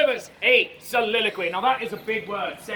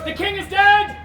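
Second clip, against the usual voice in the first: high, loud proclamation; theatrical shout; 7 semitones higher, 8 dB louder than usual. Acted. Noble proclaims the king's death, shouted. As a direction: cry the news out to a crowd. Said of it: "The noble shouts".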